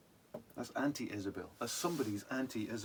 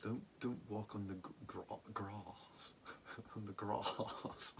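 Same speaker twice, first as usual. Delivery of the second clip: put-on posh accent; 9 dB quieter, slower than usual. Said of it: "I'm from Reading... Queen's English".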